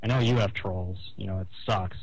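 ordinary delivery

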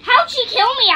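high, loud startled question; shocked outcry. Real, not acted. Player yelling at her sudden death, shocked and loud. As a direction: cry out in disbelief at being killed. Killed suddenly while jumping in game.

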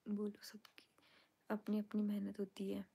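soft whisper reading fan comments late at night. Real, not acted. Streamer whispering viewers' comments aloud to her fans. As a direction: hush it, others are asleep.

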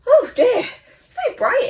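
sudden high, loud oh dear; surprised exclamation. Real, not acted. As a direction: react startled to the glare. Startled by bright light.

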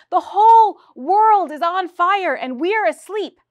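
slow, high, loud tragic lament. Acted. Playwright lamenting to the producers, slow, loud and tragic. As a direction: mourn it to the rafters, slowly. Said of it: "in a tragic voice".

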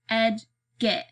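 "Edgett" in two separate syllables, ED, GET; spelled-out Edgett. A narrator's reading. Man drills the boy on "Edgett", syllable by syllable. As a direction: break "Edgett" apart to correct someone. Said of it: "It's Edgett. Got it?".

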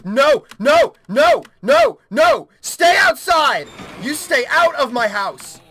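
frantic high-pitched screamed warning; high, loud, desperate yelling; panicked shouting. Real, not acted. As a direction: shout them out of the house in a panic. Panicked shouting at game creature.

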